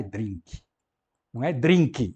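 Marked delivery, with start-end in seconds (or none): 0.0-0.6 "drink" as "drinki", extra i at the end; Brazilian mispronunciation. Shown as a demonstration. Teacher rejecting the Brazilian version, "drink" said as "drinki". 1.5-2.1 "drink" loudly as two syllables, "drinki"; Brazilian mispronunciation. Shown as a demonstration. Teacher showing an even worse version, loud "drinki". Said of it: "isso muito menos ainda".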